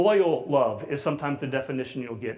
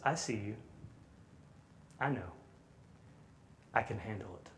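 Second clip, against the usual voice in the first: tender reassurance; 6 semitones lower, 14 dB quieter than usual. Retold or imitated. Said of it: "and says".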